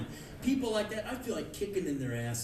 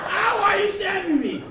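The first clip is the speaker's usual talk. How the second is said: very high, loud wail of protest; pained outcry; 11 dB louder than usual. Acted. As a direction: cry out as if being stabbed. Stab victim acted.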